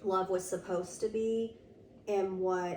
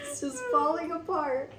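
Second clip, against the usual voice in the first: mock crying; 8 semitones higher than usual. Joking lament about failing workplace.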